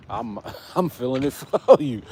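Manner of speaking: chuckling through the words; amused, laughing sympathy. Real, not acted. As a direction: laugh while you say you feel it for them. Laughs while sympathizing with viewer.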